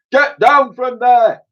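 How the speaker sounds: very high, loud, slow commanding shout; police commissioner shouting orders from below. A narrator's reading. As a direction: order them down forcefully. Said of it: "came a shout".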